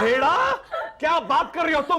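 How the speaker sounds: high, disbelieving outburst; shocked outrage. Acted. Husband hearing his wife was teased, shocked cry. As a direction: jump up in pitch with shock.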